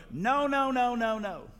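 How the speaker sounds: high-pitched run of little no's; imitating a child. Father mimicking his daughter Brittany, high child voice. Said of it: "I told you Brittany used to go".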